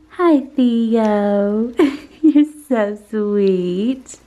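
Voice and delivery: high, slow coo with laughs. Real, not acted. Mom cooing at baby.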